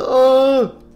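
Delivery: high loud "oh" cry; startled scream. Acted. Spring Boy seeing falling cab, startled scream. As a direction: scream a short alarmed oh.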